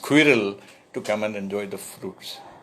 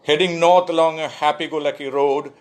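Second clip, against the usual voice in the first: louder, higher measured recital; poetry reading; 4 semitones higher, 4 dB louder than usual. Acted. Poet reading his Libya poem to listeners, measured recital. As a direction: give each image its moment.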